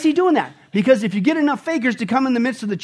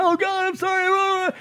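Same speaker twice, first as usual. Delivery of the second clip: high, slow wailing cry; 10 semitones higher, slower than usual. Pretend. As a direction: ham up the pitiful apology.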